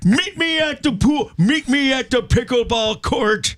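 put-on high cranky old man challenge; an old man picking a fight. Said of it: "your old man voice again".

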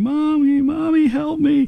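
retold scream, high and drawn out; imitated scream. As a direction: act out a small child calling for help. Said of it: "and I was screaming".

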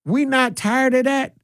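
exasperated repeat, held up high; incredulous exasperation. Real, not acted. Host pressing his community about park fights, exasperated disbelief.

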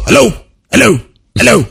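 lightly disguised phone hello; short hellos in a slightly altered voice. Shown as a demonstration. Showing a friend the voice used for spam callers, lightly disguised. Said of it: "so I disguise my voice".

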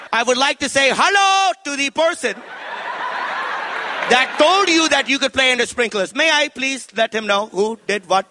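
sarcastic polite mom. Retold or imitated. Mom hunting down who allowed the sprinklers, mock-polite threat. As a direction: sweetly demand a name. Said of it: "She's like".

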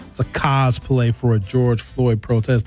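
ordinary talk, nothing marked

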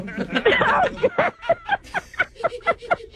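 high, breaking laughter; helpless laugh of relief; long high giggling release; relieved laugh. Real, not acted. Relief on learning of prank.

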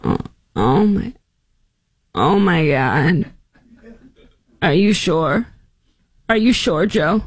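a light take on Kamala, slow, feigned surprise. Retold or imitated. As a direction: play dumb in a lightly voiced Kamala. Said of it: "I should really try to do my Kamala voice".

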